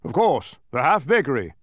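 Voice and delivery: loud, falling "half bakery"; snapping king. A narrator's reading. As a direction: snap "half bakery" back as obvious. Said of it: "snapped the king".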